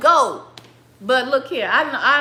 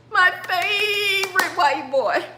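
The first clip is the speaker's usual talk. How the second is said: high, drawn-out comic wail; mock lament; 7 semitones higher, slower than usual. Father laments over his favorite son, drawn-out mock wail.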